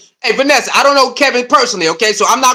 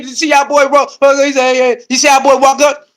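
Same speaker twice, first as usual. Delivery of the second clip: ordinary delivery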